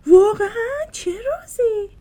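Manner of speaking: high, loud gasp of a question; surprised. Acted. Mimi asking Maya about the secret, surprised and eager. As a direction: squeal out the curiosity.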